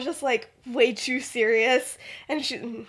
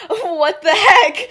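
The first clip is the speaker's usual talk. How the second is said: high, loud, stretched-out disbelief; hammed-up shock through a laugh; shocked outburst; 8 semitones higher, 12 dB louder, much slower than usual. Lily finally learning Remus's secret, mock shocked outburst.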